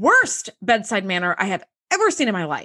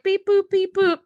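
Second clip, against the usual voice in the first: high, flat mock machine noises; mock the medic's bored button-tapping; a lightly voiced indifferent medic going beep boop; bored robotic beeping; 8 semitones higher than usual. Bored medic mocked by speaker.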